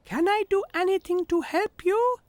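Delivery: a cheerful tiny tractor asking kindly; little tractor Tech. A narrator's reading. Tech asks poorly horse Henry, high little-tractor voice.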